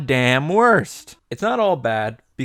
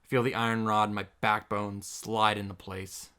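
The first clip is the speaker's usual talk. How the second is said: softer, absorbed reading of the hero's thoughts; game text reading; 11 dB quieter, faster than usual. Acted. Streamer reading the hero's inner thoughts aloud, softer. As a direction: read the inner monologue gently.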